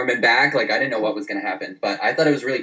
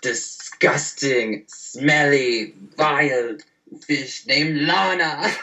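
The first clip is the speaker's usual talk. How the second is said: Rusev impression; 3 semitones higher, much slower than usual. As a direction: drag out the insult slowly in a mock Rusev voice. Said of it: "he's like".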